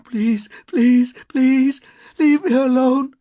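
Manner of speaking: high, loud, desperate pleading; begging. A narrator's reading. Woman scrambling away from him, begging. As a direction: beg frantically, word after word.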